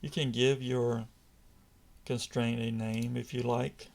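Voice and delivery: normal talking voice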